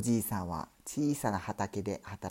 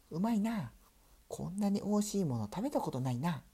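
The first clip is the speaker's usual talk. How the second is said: little mouse voice; 8 semitones higher than usual. Acted. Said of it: "と小ネズミが言うと".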